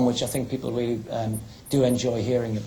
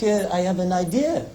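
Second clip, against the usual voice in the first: lightly put-on French accent, slightly higher; a hint of a French accent, half-mocking; 5 semitones higher than usual. Frenchman plotting to sneak into the stadium, light French accent. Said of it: "a Frenchman who spent a lot of time in Newcastle".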